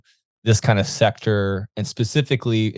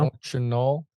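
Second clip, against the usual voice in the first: imitated North Dakota accent; 3 semitones higher, 6 dB quieter, slower than usual. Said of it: "this accent comes from".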